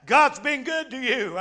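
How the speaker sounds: ordinary delivery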